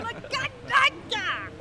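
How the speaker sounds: slow, loud, high mock curse; fake swearing. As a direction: shout it like a swear word held back. Swapping swear words, son nearby.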